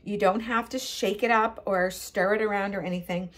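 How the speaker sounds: ordinary delivery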